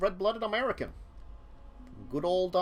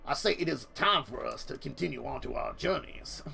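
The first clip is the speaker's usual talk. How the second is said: lightly voiced blustering Southern gent; mock Southern; 3 semitones lower than usual. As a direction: GM tries out a rich Texan voice, lightly. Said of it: "a little too Yosemite Sam".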